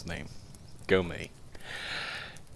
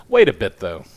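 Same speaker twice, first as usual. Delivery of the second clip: sudden smile; 8 dB louder than usual. A narrator's reading. Editor struck by an idea for the reporter, suddenly smiling. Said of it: "with a sudden smile upon his face".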